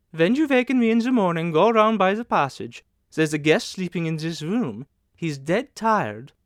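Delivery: lightly voiced matron settling a new boy in; lightly voiced manageress. A narrator's reading. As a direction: play the manageress briskly hospitable.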